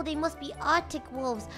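usual speaking voice

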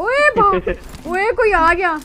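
startled cry as an enemy shows up; alarmed shout. Real, not acted. Streamer yelling to her squad as an enemy arrives, panicked.

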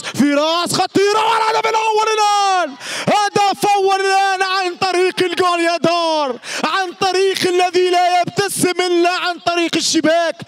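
high shouted goal announcement; celebrating the first goal; goal call. Real, not acted. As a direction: burst out on the header goal.

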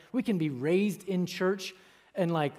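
ordinary delivery

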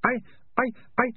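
high, halting false starts; stuttering. A narrator's reading. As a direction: stumble on the word with gaps between. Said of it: "the man stuttered".

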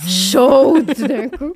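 loud, drawn-out "chaude"; slow emphatic stress on "chaude"; emphatic confession. Real, not acted. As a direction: stretch and punch "chaude". Describes her sudden flush.

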